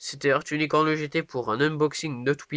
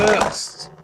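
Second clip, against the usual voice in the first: excited; 7 semitones higher, 5 dB louder than usual. Real, not acted. Calling out the burst.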